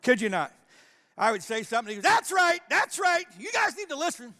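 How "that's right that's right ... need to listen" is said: high, loud amen-shouting heckler; mock preaching along. Drunk man preaching along with the pastor, loud and high. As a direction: echo the preacher like a fired-up congregant. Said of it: "preach along with me".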